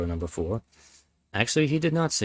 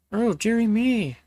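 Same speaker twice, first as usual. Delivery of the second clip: put-on high, slow old-lady sigh; drawn-out falsetto fret; high put-on old lady, slowly fretting; stupid Garrodeb voice; 12 semitones higher than usual. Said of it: "continue this stupid voice".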